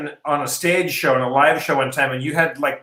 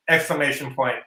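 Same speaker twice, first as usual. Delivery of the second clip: ordinary delivery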